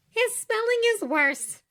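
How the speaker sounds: high, teasing and amused; amused Glinda. A narrator's reading. Glinda joking with Oscar at dinner, amused and high. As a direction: tease him about the spelling, high and light.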